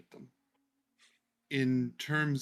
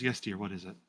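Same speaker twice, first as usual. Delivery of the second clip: lower, resigned "yes, dear" to the hero; weary Lorius; 6 semitones lower than usual. Acted. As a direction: turn to the visitor with a tired patience. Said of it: "and goes".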